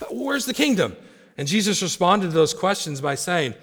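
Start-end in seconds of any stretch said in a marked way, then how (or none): 0.1-1.0 high-pitched acted questioning; insistent crowd's question. People asking Jesus about the kingdom, high insistent question. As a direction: ask again as the clamoring people, pitched way up. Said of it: "the people were asking".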